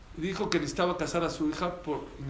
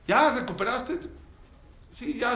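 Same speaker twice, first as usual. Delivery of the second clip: higher, louder demand; stern demand; 7 semitones higher, 4 dB louder than usual. Acted. Wife confronts husband at the door, loud. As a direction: check up on him sharply.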